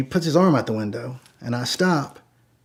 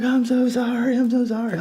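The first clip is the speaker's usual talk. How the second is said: high, tearful mock drunk woman; drunk, kinda crying; 10 semitones higher than usual. Drunk woman apologizing to her driver, high weepy imitation. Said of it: "she's drunk and she's still kinda crying".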